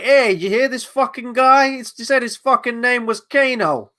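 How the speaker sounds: mock a film character as an Italian New Yorker. Streamer mocks the film's gangster to viewers, Italian New Yorker accent. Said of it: "this guy's like an Italian New Yorker".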